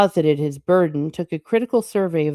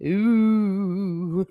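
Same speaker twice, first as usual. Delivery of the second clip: long, drawn-out "ooh" at raised pitch; teasing ooh; 4 semitones higher than usual. Real, not acted. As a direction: draw out a mock-impressed ooh. Reader reacts to devil taunt.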